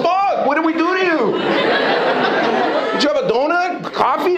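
high laughing mock shock. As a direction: act startled at the faces. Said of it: "I'm going".

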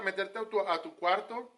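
normal talking voice

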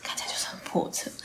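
whispered. Shown as a demonstration. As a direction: breathe the words like a secret. Repeats description, then whispers it.

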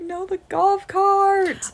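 high, slow, wailing mock lament; mock heartbreak. Kids mourning the broken golf cart, mock wail. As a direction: wail it like the world ended. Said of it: "Everyone was like".

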